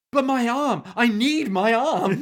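high, loud mock wail over her arm; whiny Pris. Pris crying out as the boomer pins her, mock wail. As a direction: ham up a panicked whine, slowly. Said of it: "And she's like".

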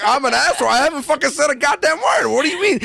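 high-pitched mock outrage; retold incredulous objection; indignant protest. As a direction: protest your innocence, pitch climbing. Acted indignant protest in retold quarrel.

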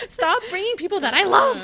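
high, loud exasperated begging; mock desperate plea. Acted plea, friends endangered.

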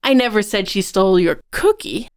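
"cookie" punched at the very end; stress on COOKIE. Shown as a demonstration. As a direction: save the weight for "cookie". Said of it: "you can emphasize each word".

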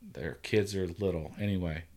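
normal talking voice

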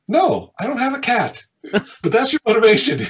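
high, loud, emphatic denial; gleeful punchline reveal. Retold or imitated. Punchline revealing the sad story was fake.

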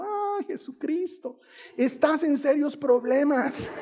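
high, slow comic lament; drawn-out, high-pitched playful warning; mock dismay. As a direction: wail it to heaven in mock despair. Retells his dismayed prayer to Jesus.

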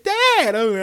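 put-on high, sweet child calling Dad; tiny kid's high Dad; little kid voice. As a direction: squeak it like a small boy. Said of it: "when he said".

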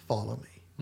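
gentle reassuring. Retold or imitated. Jesus voiced in retold scene.

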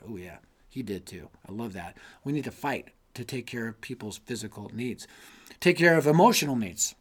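ordinary talk, nothing marked

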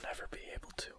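very soft, voiceless whisper. Real, not acted. Co-host taunting the exasperated host, whispering. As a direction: whisper it, barely audible. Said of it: "I'm muting all the whispering".

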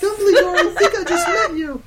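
very high, loud exclamation; excited girl voice. Acted. Girl greeting Dumbledore in his classroom, excited. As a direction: squeal it at the headmaster. Girl's excited acted line in story.

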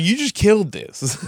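slow mock reproach. Scolding DJ who ruined night.

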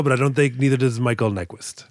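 ordinary delivery

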